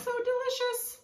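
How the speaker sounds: high, thrilled delight; excited. Real, not acted. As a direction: gush over the food. Admiring finished salad.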